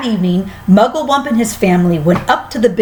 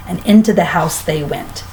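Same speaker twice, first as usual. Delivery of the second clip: plain reading voice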